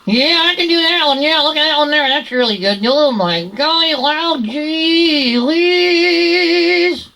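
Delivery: high nasal cartoon character; nasal puppet voice, stretching the last word out. Shown as a demonstration. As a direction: push it up into the nose, high and whiny. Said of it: "up in the navel cavity".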